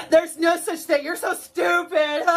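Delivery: sneering laughing mimicry, pitched high; mocking laugh. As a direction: laugh at them while mocking.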